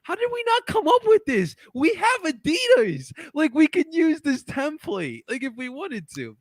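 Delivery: high, excited disbelief. Retold or imitated. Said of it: "I'm just like".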